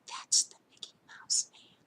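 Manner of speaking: hushed, voiceless warning; secretive whisper. Retold or imitated. Mother pointing out a man to her son, secretive whisper.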